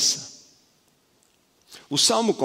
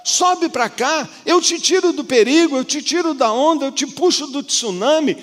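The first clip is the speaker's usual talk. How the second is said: much higher and louder, urgent rescuing plea; impassioned preaching; 12 semitones higher, 6 dB louder than usual. Acted. God reaching out to save the drowning believer, in fervent high, loud preaching. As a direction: preach like pulling someone from the waves.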